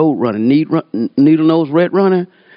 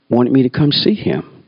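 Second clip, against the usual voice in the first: ordinary talk, nothing marked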